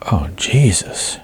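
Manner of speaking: whispered, slow and breathless; murmured. Acted. Sarah hearing her coach died, whispered slowly. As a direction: breathe it out in shock. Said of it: "she murmured".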